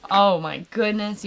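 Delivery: ordinary talk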